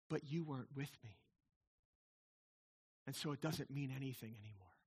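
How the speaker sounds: low, very soft movie-hero confession; heartfelt confession. Acted. Movie hero confesses to his woman, tender and hushed. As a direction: drop right down, soft and intimate.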